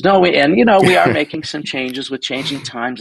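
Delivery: a hint of a careful company man easing in bad news; imitating the Canon rep.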